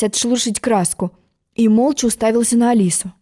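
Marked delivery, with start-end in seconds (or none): none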